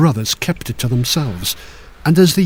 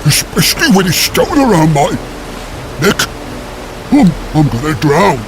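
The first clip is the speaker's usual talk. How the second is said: panicked drowning giant; 3 semitones higher than usual. A narrator's reading. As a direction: shout as you go under.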